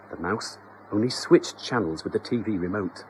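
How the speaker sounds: plain reading voice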